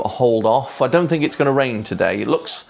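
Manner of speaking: usual speaking voice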